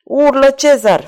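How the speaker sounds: high, slow, drawn-out cry; triumphant shout. A narrator's reading. Escaped rider taunting Cezar over his shoulder, shouting. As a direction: call out in defiance, stretching the words. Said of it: "strigă Ragastă".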